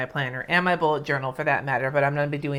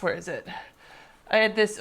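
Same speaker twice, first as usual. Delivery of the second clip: normal talking voice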